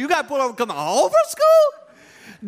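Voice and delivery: laughing. Retold or imitated. Dad laughing at son's second ticket, laughing. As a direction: laugh through the question. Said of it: "I'm laughing".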